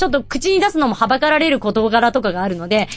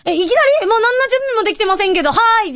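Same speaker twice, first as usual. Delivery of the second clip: flustered surprise; 11 semitones higher, faster than usual. Retold or imitated. Flustered fan caught off guard.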